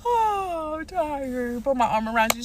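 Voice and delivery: high, fake-tired mock yawn; mocking the date. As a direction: play his cheesy move with a fake whine. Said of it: "you know the".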